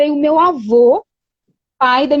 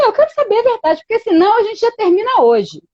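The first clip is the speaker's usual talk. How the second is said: retold stern ultimatum, pitched higher; husband's ultimatum; 8 semitones higher than usual. Retelling to Wilma how her boyfriend demanded an answer, mock stern. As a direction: mimic him demanding the truth, half-playful.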